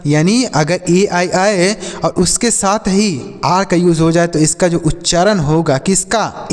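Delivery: ordinary talk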